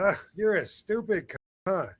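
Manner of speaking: light insult tossed off, no bite; casual teasing. Shown as a demonstration. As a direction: say it like ribbing a friend. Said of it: "I'm saying it just like that".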